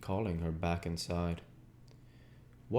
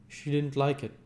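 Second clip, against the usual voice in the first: higher, lightly voiced Gatsby, downcast; a dejected Gatsby, pitched up; Gatsby's voice; 8 semitones higher, 4 dB louder than usual. A narrator's reading. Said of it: "he said immediately".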